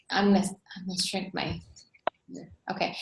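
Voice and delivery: soft unvoiced muttered aside to herself; whispered aside. Real, not acted. Presenter adjusting her screen mid-talk, a whispered aside. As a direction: whisper it under her breath.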